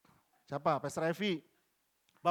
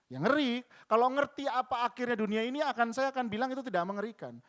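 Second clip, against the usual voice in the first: higher, louder, driving sermon delivery; fervent preaching; 7 semitones higher, 5 dB louder than usual. Acted. Preacher to the congregation, fervent and loud. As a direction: preach it with rising conviction.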